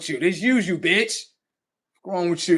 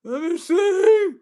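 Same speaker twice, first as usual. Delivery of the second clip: much higher, whimpering fake cry; mock weepy giving in; 11 semitones higher, 5 dB louder, slower than usual. Mocking the brother in a whiny fake cry. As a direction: give in with hammed-up sniffles.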